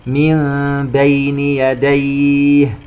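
slow chant, "من" turned to a hummed m before "بين"; iqlab. Shown as a demonstration. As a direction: hum "من" as mim into "بين". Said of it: "إقلاب ويترتب عليه حكم الإخفاء الشفوي". (ترتيل بقلب نون "من" ميما قبل "بين")

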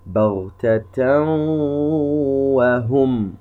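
بغتة وهم chanted slowly as baghtataw-wahum, hummed W; merging with ghunna. Shown as a demonstration. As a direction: hold a nasal W joining بغتة to وهم. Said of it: "وأصبح عندنا حرف الواو مشددا". (إدغام بغنة في بغتة وهم مرتلة)

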